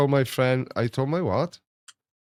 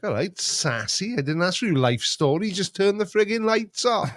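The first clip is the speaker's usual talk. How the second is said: higher-pitched playful scolding; mock exasperated retort; 8 semitones higher than usual. Owner telling Google to just switch lights, mock exasperation. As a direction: tell off a cheeky gadget. Acted scolding of a cheeky device.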